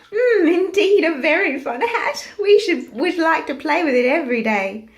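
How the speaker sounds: high, loud playful monkey chatter; gleeful band of monkeys; monkeys crying out. Acted. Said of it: "cried the monkeys".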